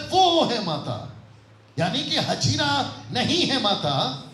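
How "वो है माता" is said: "वो" long and strong, then falling; stress on वो. Shown as a demonstration. Preacher voicing the writer, with a long emphatic "वो". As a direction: draw out "वो" emphatically. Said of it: "वो कहता है".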